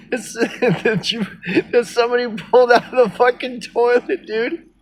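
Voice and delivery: high, giggling through the words; laughing. Real, not acted. Laughing to viewers at his own gross sandwich joke.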